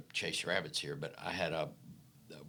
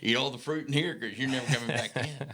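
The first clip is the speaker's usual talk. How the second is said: higher and louder, laughing through it; laughing mock resignation; 5 semitones higher, 4 dB louder than usual. Telling the host his doomed thought, laughing. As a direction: joke that you're doomed, giggling. Retold inner joke, laughing.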